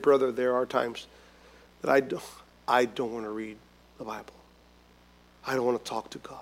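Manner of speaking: quiet confession. Retold or imitated. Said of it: "And he said".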